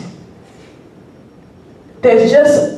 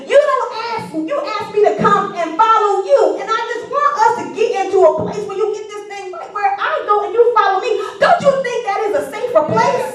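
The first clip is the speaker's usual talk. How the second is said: much higher, building preacher's cry; preaching at full force; 10 semitones higher than usual. Acted. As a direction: drive the sermon up to a climax.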